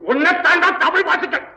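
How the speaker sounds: ordinary talk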